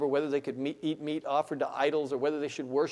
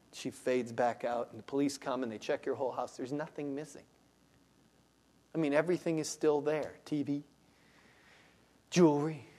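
usual speaking voice

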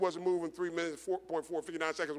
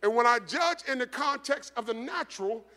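higher, louder preaching climb that slows down; preaching at full force; 6 semitones higher, 6 dB louder than usual. Real, not acted. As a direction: build the sermon to its peak. Sermon builds to climax.

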